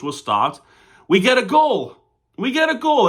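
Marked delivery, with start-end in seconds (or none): 1.1-2.0 high, loud joyful cry; real burst of delight; excited goal call. Real, not acted. 2.4-3.1 high, loud celebratory cry; rising joy at a goal; excited goal call. Real, not acted.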